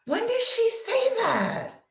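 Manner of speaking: high incredulous question; surprised. Real, not acted. Asking viewers about the gossip in high disbelief.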